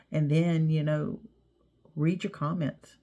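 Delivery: ordinary talk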